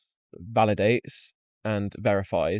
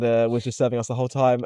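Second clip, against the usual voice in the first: usual speaking voice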